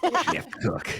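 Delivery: a lightly done rock creature; golem voice. Acted. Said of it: "Oh, yeah, the golem voice".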